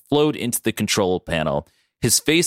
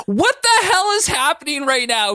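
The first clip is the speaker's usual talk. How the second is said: very high, exasperated scream; 4 dB louder than usual. A narrator's reading. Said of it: "Katie screamed".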